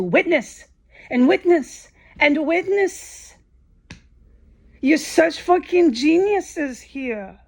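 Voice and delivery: bitter sarcasm. Real, not acted. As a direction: drag it out with contempt for the court staff. Sarcastic rant at the courthouse.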